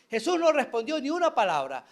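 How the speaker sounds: ordinary delivery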